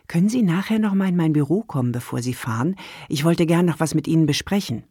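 usual speaking voice

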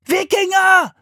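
put-on very high, loud boy's cry; drawn-out warning yell; alarm shout. A narrator's reading. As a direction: raise the alarm. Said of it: "rief Philipp".

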